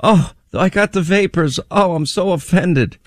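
high, hammed-up offended whine; mock the clutching of pearls; fake hurt pouting. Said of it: "feign their phony moral outrage".

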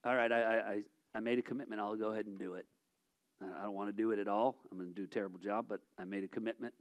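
sulky muttering of someone stuck with a promise; mock reluctant volunteer grumbling about a commitment; reluctant complainer. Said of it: "if someone says".

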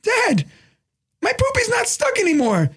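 very high imitated kid calling dad; little son. Son to dad in waiting room, high kid voice. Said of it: "He's like".

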